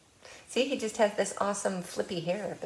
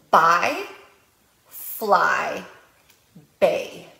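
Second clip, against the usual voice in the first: each word set apart, loud and clear; rhyme quiz words; 8 dB louder, much slower than usual. Shown as a demonstration. Librarian showing parents how to quiz rhymes, loud and clear. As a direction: lay out the three words slowly for a child. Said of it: "So you might say".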